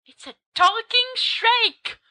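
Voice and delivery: very high, slow exclamation; mock amazement. Heckling listener interrupts the ghost story, high mock amazement. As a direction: interrupt with exaggerated wonder.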